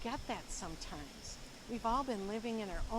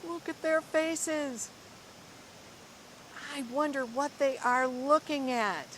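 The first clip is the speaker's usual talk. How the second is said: lively, expressive storybook reading; reading to children; 3 semitones higher, 6 dB louder than usual. Acted. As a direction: read it like a picture book to a class. Teacher reads picture book aloud.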